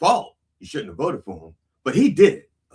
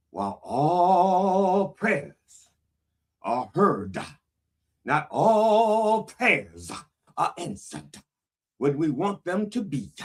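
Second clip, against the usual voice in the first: full preacher cadence; 3 semitones higher, slower than usual. Acted. Reverend intoning a prayer disclaimer, preacher cadence. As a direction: draw out "all" like a winded revival preacher. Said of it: "I can't keep that up for a full hour".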